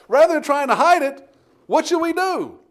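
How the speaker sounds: usual speaking voice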